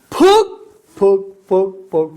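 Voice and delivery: high, loud mock thud noises; sounding out the stick hits; imitated thuds. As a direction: punch each thud out.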